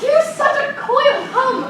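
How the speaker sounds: exasperated pleading. Acted. Juliet exasperated.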